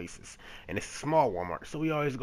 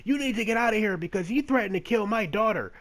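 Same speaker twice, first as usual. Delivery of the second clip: mock high accusing woman's voice, raised; 9 semitones higher, 5 dB louder than usual. Stranger's girlfriend accusing him in the aisle, mock high shrill-free raised voice. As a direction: play the accuser, pitched up and louder.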